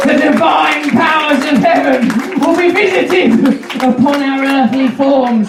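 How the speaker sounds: high, booming sermon shout; preaching Jesus. Acted. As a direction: preach like a messiah to crowds. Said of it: "you've got to accept I'm the new Jesus".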